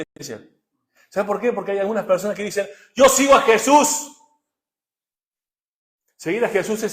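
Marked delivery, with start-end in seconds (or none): 2.9-4.2 high, loud, slow declaration; mock proclamation. Preacher mimics church members claiming faith, loud mock proclamation. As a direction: proclaim it grandly, drawing it out.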